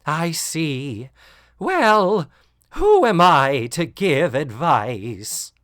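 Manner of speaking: a loud, slow mock sigh of resignation; hammed-up weary sigh; sighing resigned. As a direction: sigh it out, giving up on the girls. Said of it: "just sighs and says".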